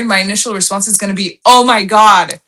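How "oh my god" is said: louder re-enacted exclamation; demonstrating an excited outburst; excited reaction. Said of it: "you told me an exciting story".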